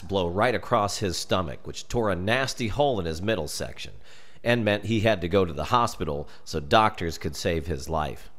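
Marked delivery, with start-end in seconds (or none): none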